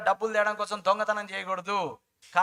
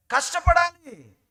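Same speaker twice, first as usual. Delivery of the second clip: emphatic shout; 8 semitones higher, 8 dB louder, much slower than usual. Real, not acted. Preacher driving home point.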